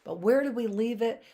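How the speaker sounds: usual speaking voice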